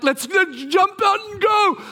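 high, shouted burst of eagerness; eager shout. Retold or imitated. Leader rallying the group to head out, eager shout. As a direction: whoop it out, raring to go. Eager to leave despite rain.

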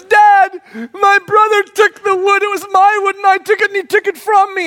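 imitating son tattling to Dad; whiny child complaint. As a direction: whine it like a wronged little boy. Son complaining about wood.

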